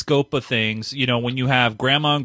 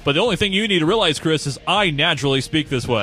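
radio voice; 2 semitones higher than usual. Acted. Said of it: "doing that voice the whole time".

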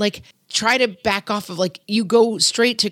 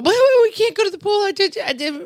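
put-on high-pitched protesting child, louder, pleading; whiny kid; 13 semitones higher, 3 dB louder, faster than usual. Son protesting to mom about going to the pool, high whiny kid voice. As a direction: wail it like a kid who'd rather stay home.

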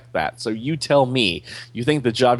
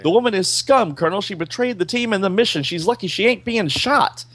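hammed-up angry boss, higher; mild mock yell; 10 semitones higher than usual. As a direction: snap back at the colonel in anger. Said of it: "she's screaming back".

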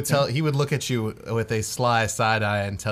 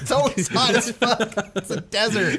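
laughing high through the words; cracking up at the joke; 11 semitones higher than usual. Real, not acted. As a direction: giggle it out. Table laughing at desert joke.

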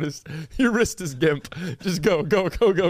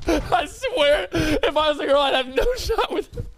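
high-pitched laughing whine; cry-laugh it out; laughing lament; 9 semitones higher than usual. Real, not acted. Laughing despair about his chances as a girl.

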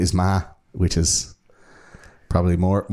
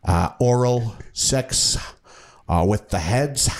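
lightly voiced old police detective, slow and halting; mock-deliberate retelling with awkward pauses; weird repetitive detective; much slower than usual. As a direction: draw out each phrase like a documentary detective. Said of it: "saying it in such this weird, repetitive".

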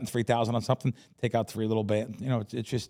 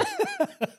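a high, amused burst of laughter; quick delighted laugh; real laugh. Real, not acted. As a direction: laugh at your own cheek. Laughs at his own boast.

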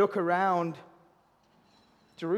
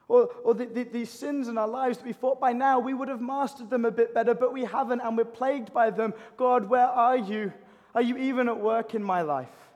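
raised, high-pitched lament to God; exasperated pleading; 5 semitones higher, 4 dB louder than usual. Acted. Doubting believer asking God if he is at work, exasperated. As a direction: cry out in frustration at unanswered struggles.

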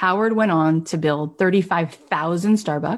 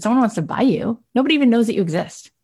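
retold disbelief; 5 semitones higher, faster than usual. Buyer reacting to a rival offer, in disbelief. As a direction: throw the news back, mildly incredulous.

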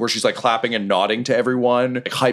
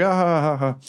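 crowd laughter; 7 semitones higher than usual. Acted. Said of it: "And everyone's like".

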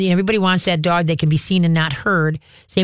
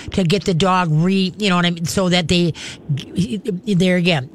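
usual speaking voice

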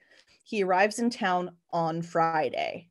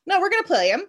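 much higher, louder insistence; Billy insisting; 4 semitones higher, 5 dB louder, faster than usual. Retold or imitated. Billy overruling the coach, higher and louder. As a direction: shout him down, no way. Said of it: "He's like".

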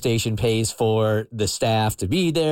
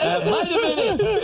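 high squeaky laughing repeat; mock high voice. Joking about the manager's payback, high laughing mock voice. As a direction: pitch it way up, giggling.

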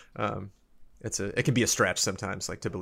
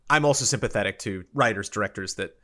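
ordinary talk

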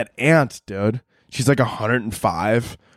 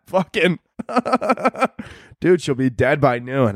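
ordinary talk, nothing marked

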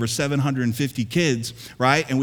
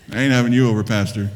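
much lower, chuckling through it; laughing joke; 6 semitones lower, much faster than usual. Real, not acted. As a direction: tease them while laughing. Jokes laughing at the crowd.

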